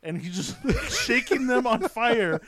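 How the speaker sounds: cracking up at the memory; laughing. Real, not acted. Telling her cohost about the burning hands, cracking up.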